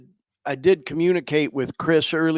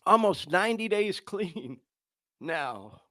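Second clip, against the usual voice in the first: choked up; 7 dB quieter than usual. Real, not acted. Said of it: "that I'm getting emotional".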